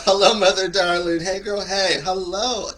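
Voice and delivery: high, loud, sweet greeting; dutiful son voice. Acted. The host greets his mother in the chat, loud and sing-song. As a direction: put on your best-behaved son greeting. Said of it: "this is how he talks in front of his mother".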